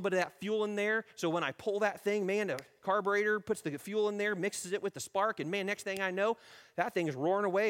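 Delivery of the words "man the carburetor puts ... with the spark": ordinary talk, nothing marked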